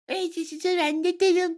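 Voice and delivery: high, squeaky mocking parrot; small high-pitched jeering voice; mock squeal. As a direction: parody him in a thin falsetto. Mocking Farage's claim from abroad.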